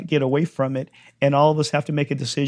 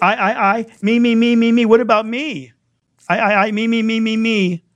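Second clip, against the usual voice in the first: loud, high, insistent mock complaint; mock self-centred; 8 semitones higher, 5 dB louder than usual. Preacher imitating people he dealt with this week, mock self-centred. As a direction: hammer it like someone self-absorbed.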